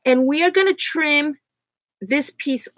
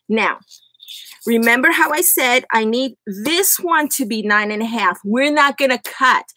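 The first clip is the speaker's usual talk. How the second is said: ordinary delivery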